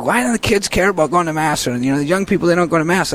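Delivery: mock a frustrated dad's complaint. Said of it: "he gets frustrated".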